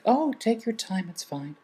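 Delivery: high, slow, airy gate-agent voice; breezy gate agent. Retold or imitated. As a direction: float it up, far too relaxed.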